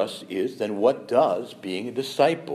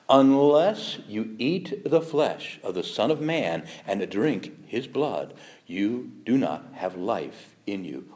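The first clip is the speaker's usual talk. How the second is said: solemn scripture reading; much slower than usual. Acted.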